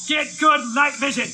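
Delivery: usual speaking voice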